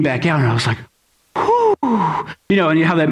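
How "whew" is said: relieved whew. Retold or imitated. Relief after finding lost son.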